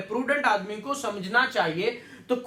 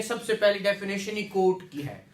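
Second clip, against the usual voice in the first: ordinary talk, nothing marked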